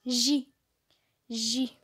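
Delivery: J said as a clear, drawn-out French zh sound; sound J demo. Shown as a demonstration. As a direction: show the kids the J sound with the mouth half open. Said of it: "Vous devez avoir la bouche entrouverte".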